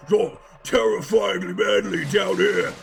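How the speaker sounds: loud, slow, rough impression of a game hero; Russian game protagonist. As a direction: drag it out loudly as a terrifying game protagonist. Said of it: "In Russian, the pro-, protagonist".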